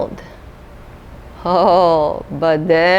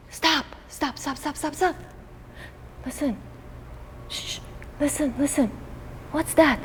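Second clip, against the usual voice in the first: very soft, hushed stops; hushed whisper; 5 semitones higher, 12 dB quieter, faster than usual. Acted. Teacher whispering to children to listen. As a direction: whisper so nobody hears us.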